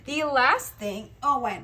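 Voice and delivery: strained, slow words while bending; bending over. Real, not acted. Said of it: "why do I talk while I bend".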